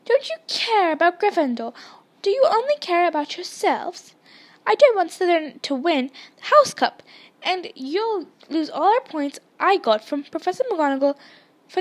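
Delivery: loud, high-pitched indignant telling-off; heated, voiced reproach at full push; angry hissing scold. A narrator's reading. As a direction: scold them like an angry goose. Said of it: "hissing at him like an angry goose".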